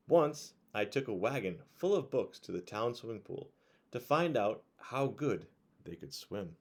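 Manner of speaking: put-on bright, lively read-aloud voice for kids; reading a picture book to children at bedtime; storybook narration.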